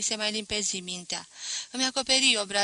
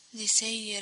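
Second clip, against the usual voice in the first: usual speaking voice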